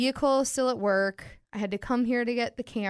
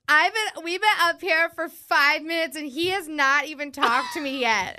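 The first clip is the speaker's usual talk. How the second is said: higher, louder whiny complaint; whiny drunk friend; 5 semitones higher, 4 dB louder than usual. Retold or imitated. Drunk friend complaining about her fiance, high whine. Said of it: "She was like".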